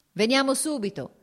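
called out. A narrator's reading. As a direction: call it across the room, brisk. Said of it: "gridò Vronsky all'ufficiale".

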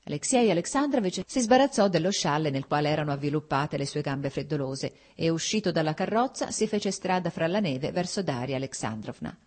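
usual speaking voice

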